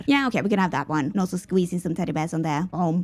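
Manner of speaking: usual speaking voice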